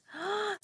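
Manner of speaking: high, sudden gasp of realization; sharp gasp. Retold or imitated. Recognizing her abuela's arm in her own, a sharp gasp. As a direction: catch your breath as it dawns on you.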